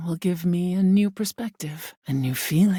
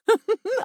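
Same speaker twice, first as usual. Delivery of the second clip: high delighted laugh; 14 semitones higher than usual. Acted. Delighted by new voice.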